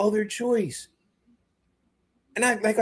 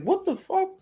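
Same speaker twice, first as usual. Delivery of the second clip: shocked; 13 semitones higher than usual. Retold or imitated. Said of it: "'Cause I was like".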